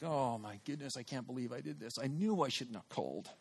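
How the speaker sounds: low, hushed self-reproach; quiet regret. Retold or imitated. Caller regrets phoning after silence, quietly mortified. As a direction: murmur it low to yourself. Regretting the call, retold thought.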